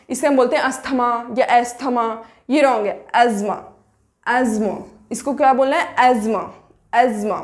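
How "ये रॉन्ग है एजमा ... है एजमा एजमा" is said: एजमा said "az-ma", silent th; correct pronunciation. Shown as a demonstration. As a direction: say एजमा with no th sound. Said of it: "correct pronunciation है".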